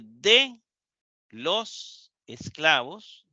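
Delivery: ordinary talk, nothing marked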